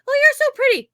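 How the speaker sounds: very high, loud fake compliment; imitated two-faced flattery; surface-nice. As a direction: gush it high with put-on sweetness. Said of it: "they're surface nice".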